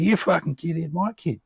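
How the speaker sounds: high-pitched mock confrontation; imitated angry dad. Brother confronting neighbours over his daughter, imitated anger.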